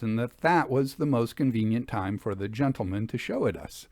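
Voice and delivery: usual speaking voice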